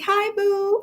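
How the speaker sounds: playful high greeting. Real, not acted. Greeting Mike in chat.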